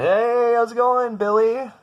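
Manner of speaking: loud, high-pitched hearty hail; imitating a booming, over-friendly hello; hollered greeting. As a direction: call out to an old acquaintance across a crowd. Acquaintance calls out to mom.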